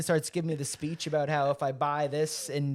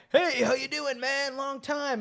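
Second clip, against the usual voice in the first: upbeat ambush greeting; 9 semitones higher, slower than usual. Retold or imitated.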